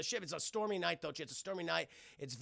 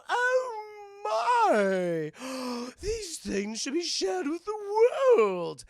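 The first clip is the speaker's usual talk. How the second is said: high, loud gasping awe at a treasure; awed gasp; 8 semitones higher, 7 dB louder, much slower than usual. Acted. Couple finding the scrolls, gasping high and loud. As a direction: gasp it out like finding a holy relic.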